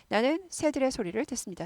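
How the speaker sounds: usual speaking voice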